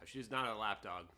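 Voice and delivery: usual speaking voice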